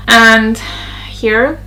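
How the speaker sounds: ordinary talk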